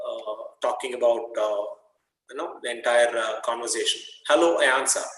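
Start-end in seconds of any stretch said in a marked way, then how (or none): 4.3-5.1 bright read of the dialogue; reading the story to students; reading aloud. Acted. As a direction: read the quote out for the class.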